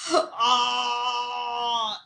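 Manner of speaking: raging little man's shriek; cri de rage; angry scream. A narrator's reading. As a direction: let out a furious yell. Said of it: "Il hurla et cria".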